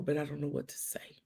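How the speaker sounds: soft unvoiced whisper of helplessness; whispered confession. Texting the grieving friend, a hushed admission. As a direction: hush it like a confession.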